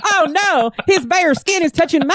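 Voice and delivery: high, hammed-up alarm; Rogue. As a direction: melodramatic comic-book panic. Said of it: "Friedrich tears Rogue's costume".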